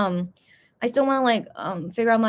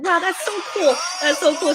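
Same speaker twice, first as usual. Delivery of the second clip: imitating excited idols; 7 semitones higher than usual. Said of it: "And they were like".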